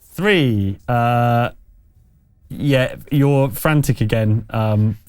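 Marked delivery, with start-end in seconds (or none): none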